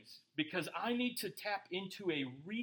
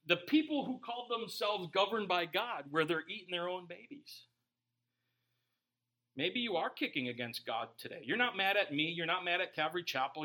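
ordinary talk

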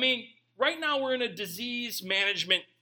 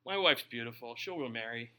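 resigned mutter; 8 semitones lower, 5 dB quieter than usual. Retold or imitated. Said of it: "I'm like".

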